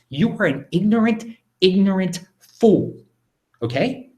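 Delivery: high-pitched, indignant scolding; heated, emphatic telling-off; angry rebuke. Real, not acted. Repeats the rebuke to questioner.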